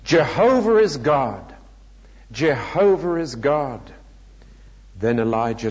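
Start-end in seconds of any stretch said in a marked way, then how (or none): 0.0-3.8 mildly raised proclamation, stretched out; restrained shout. A narrator's reading. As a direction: proclaim it like a crowd's cry, held back.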